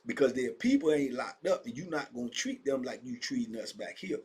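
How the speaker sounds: normal talking voice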